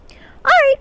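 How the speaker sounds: very high, loud pixie voice. Acted. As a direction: chirp it like a tiny fairy. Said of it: "pixie voice it is".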